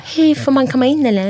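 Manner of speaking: mock-nervous, high-pitched plea; hammed-up nervous asking. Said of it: "är nervös och bara".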